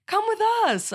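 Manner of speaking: high, loud eager come with us; eager invitation. Retold or imitated. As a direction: invite them along with excitement. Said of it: "I'm like".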